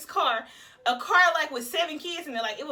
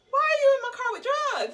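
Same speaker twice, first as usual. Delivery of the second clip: higher, louder disbelieving outburst; shocked; 12 semitones higher, 5 dB louder than usual. Retold or imitated. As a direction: confront him in disbelief. Shocked at passenger's drugs.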